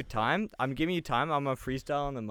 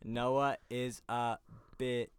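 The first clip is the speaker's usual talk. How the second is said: slow recital; 4 semitones lower, 7 dB quieter, much slower than usual. Shown as a demonstration. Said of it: "five syllables".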